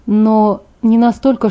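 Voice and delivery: ordinary delivery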